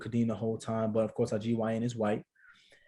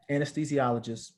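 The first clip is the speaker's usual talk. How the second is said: ordinary delivery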